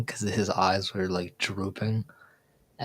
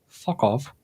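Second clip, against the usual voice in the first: parrot imitation; 3 semitones higher than usual. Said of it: "And he's like".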